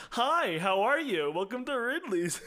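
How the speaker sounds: mock polite cashier welcome; customer service voice. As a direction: greet a customer with overdone friendliness. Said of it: "very kind, like, customer service voice".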